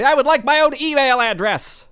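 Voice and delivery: mock grand request; proud declaration. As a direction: announce it with pompous pride. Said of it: "hold my head high and say".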